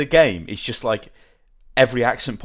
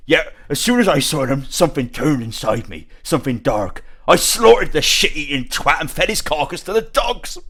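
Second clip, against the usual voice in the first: slow, put-on rough English accent; gruff British accent. Shown as a demonstration. Showing off Witcher dialogue to friends, rough British accent. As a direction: read it like a grim Witcher villager. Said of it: "Get ready for my amazing accent".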